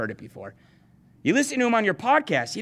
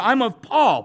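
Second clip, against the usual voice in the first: mock believer; 3 semitones higher, 4 dB louder, slower than usual. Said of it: "You know".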